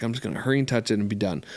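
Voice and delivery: rushing. Retold or imitated. Rushing to touch the horse.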